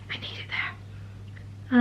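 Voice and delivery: whispered under the breath. Shown as a demonstration. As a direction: breathe the words out softly.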